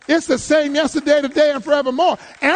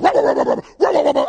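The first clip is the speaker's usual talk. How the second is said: quick higher sing-song rubber; playful mock chant; 4 semitones higher, 5 dB louder, much faster than usual. Preacher acting out walking over dog, loud playful chant. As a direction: bounce the words as you stride past. Imitating stepping past the dog.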